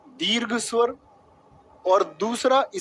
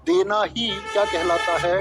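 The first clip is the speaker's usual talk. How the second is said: slow, word-by-word dictation with held endings; dictating. Shown as a demonstration. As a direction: dictate it slowly for the class to write. Teacher dictates exam question while writing.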